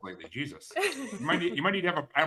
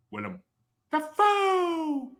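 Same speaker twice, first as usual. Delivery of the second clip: excited; much slower than usual. Real, not acted. Cries out the actor's name.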